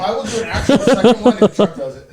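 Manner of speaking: laughing. Real, not acted. Laughing boast.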